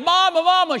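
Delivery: high, loud cry for mother; frightened child's cry. Retold or imitated. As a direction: act out a scared boy calling out. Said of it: "He cried".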